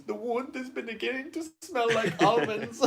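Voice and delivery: very high, slow put-on voice; film character quote. Co-host doing favourite film line, high hammed-up voice. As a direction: squeeze it out high, nearly laughing. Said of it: "The one I sometimes use is".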